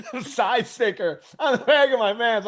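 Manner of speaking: high, loud, helpless laughter; laughing through words. Real, not acted. Storyteller revealing his pants blunder, laughing hard. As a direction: crack up at your own punchline. Cracking up at his own punchline.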